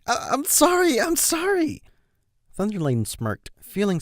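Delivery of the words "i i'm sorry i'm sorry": high, stammering pleading sorry; panicked apology. Acted. Colt to his brother, high panicked sorry. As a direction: beg him to stop, fast. Colt begging under noogie.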